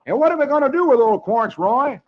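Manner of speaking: high, loud eager call; eager boy voice. Acted. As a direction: ask it high and keen. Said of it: "Brad asks".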